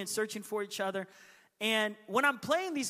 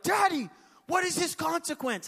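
put-on high, indignant child's call; mock outraged little brothers; indignant kids; 9 semitones higher, 3 dB louder than usual. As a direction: imitate kids appealing loudly to Dad. Tattling brothers demanding justice, acted.

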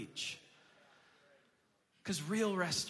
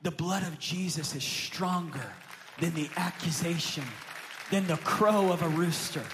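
rising, louder preacher's declaration; preaching; 6 dB louder than usual. Real, not acted. Pastor declaring to church, building preach.

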